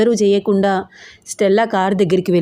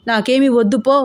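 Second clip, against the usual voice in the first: mild shout; 3 semitones higher than usual. Acted. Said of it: "అరిచింది".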